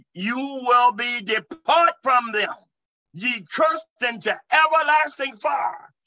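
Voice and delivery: high, slow preacher's proclamation; ringing pulpit sentence of judgment; commanding pronouncement. Retold or imitated. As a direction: pronounce doom on the demon, drawn out.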